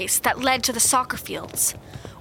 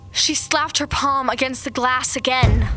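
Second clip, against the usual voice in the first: usual speaking voice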